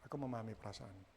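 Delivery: very soft, low reassurance; hushed, tender empathy; understanding husband. Shown as a demonstration. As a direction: say it quietly, fully attentive. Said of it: "terus suami bilang gini".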